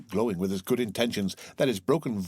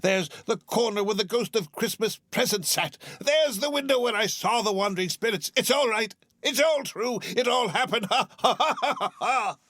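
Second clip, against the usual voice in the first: high, rising to a full laugh; joyful laughing Scrooge; 10 semitones higher than usual. Acted. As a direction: laugh with pure relief.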